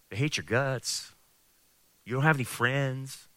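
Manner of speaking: very soft, needling tempter; devil's whisper. Acted. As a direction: quietly coax the listener to give up. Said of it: "the devil will whisper into your ear".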